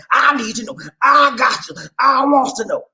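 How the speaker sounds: high, loud imitation of the caller; high mock quote. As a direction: squeeze it up high and pleading.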